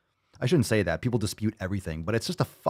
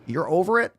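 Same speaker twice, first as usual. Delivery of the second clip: mock incredulous; 8 semitones higher than usual. Mocking a comment's complaint.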